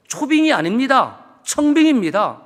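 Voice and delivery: 청빙입니다 said loud and slow, first syllable 청 hit hard; correcting a word. Shown as a demonstration. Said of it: "그걸 모르더라고요". (초빙 아닌 청빙을 또박또박 강조)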